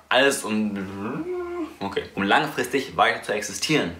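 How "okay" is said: normal talking voice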